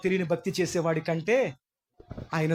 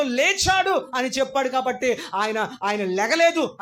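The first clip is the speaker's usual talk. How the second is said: fervent sermon climax; preaching at full force; 8 semitones higher than usual. Acted. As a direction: build the list of martyrs.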